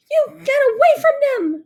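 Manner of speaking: very high, loud furious bellow; bear bellowing. A narrator's reading. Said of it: "she bellowed".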